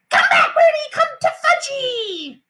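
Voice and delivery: put-on very high toddler shriek; small boy screaming. A narrator's reading. Fudge screaming after a pigeon, very high shriek. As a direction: scream after the bird like a little kid. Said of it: "was screaming".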